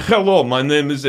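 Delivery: mock Russian accent with a throaty H. Shown as a demonstration. Host shows co-hosts a throaty Russian hello, mock Russian accent. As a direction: demonstrate a hammed-up movie Russian accent. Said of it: "that's the Russian accent they used".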